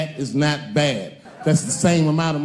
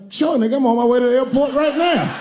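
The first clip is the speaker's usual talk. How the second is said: higher, eager reply; eager yes; 7 semitones higher, 4 dB louder than usual. Retold or imitated. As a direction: jump at the invite, voice up high. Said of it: "And I was like".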